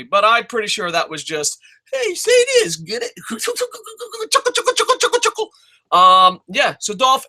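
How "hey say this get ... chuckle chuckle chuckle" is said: squeaky, very high cartoonish voice; silly falsetto with spoken chuckles; mock joke-feeder. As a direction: mimic someone tickled by their own joke. Mocking writers feeding a joke.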